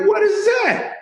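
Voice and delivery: squeaky laughing question; laughing high squeal. Retold or imitated. Amazed comedian asking about Roll Tide, laughing squeal. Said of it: "I was like".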